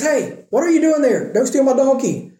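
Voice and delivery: high, light mock protest from the donkey owner; a preacher hamming up a startled villager; mock alarmed owner. Owner catching them untying his donkey, mock alarmed.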